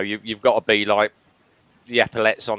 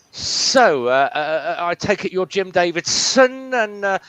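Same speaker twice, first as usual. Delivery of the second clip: higher, louder, slow pompous sergeant major; stern sergeant major; 8 semitones higher, 4 dB louder than usual. Retold or imitated. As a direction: play the pompous officer greeting the celebrity. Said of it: "and he went".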